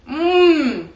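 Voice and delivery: a pleased hum at the taste; delighted mmm. Real, not acted. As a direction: savour the first spoonful out loud. Tasting the yogurt again.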